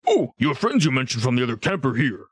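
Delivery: lightly voiced ogre; a slightly put-on character voice. Ogre reporting visitors from the other camp, lightly voiced.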